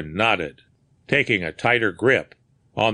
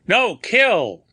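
high, loud broken-English shout; frightened foreigner yelling; vociferating; 11 semitones higher, 5 dB louder than usual. A narrator's reading. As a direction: shout your innocence in a panic. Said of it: "he vociferated".

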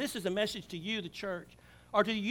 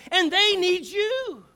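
1 urgent plea; 9 semitones higher, 10 dB louder, much slower than usual. Real, not acted. Preaching climax, urgent plea.

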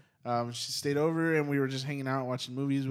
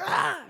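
high-pitched imitated scream; mock yell; 4 dB louder than usual. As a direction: act out a nightmare scream without full volume. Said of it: "but this time she yells".

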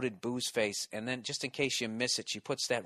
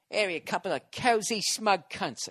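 English accent; 7 semitones higher than usual. Shown as a demonstration. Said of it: "in the English accent".

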